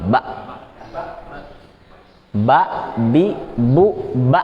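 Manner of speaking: "Ba, bi, bu" each cut off by a glottal stop; slightly pressed stops. Shown as a demonstration. Teacher drilling learners, short syllables with pressed endings. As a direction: press briefly at the end of each "ba". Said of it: "kayak agak ditekan sedikit".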